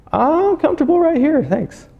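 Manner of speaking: high, sing-song brush-off; mock whiny refusal. Acted lazy believer refusing God.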